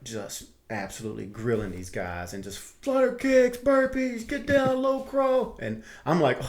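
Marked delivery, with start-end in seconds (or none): 2.6-5.6 high, slow, barked drill commands; drill instructor commands. Retold or imitated. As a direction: call out exercises like a drill instructor. Instructors grilling the students.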